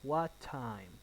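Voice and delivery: "What time" drawn out and falling; falling intonation. Shown as a demonstration. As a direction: stretch it, dropping on "time". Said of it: "falling intonation".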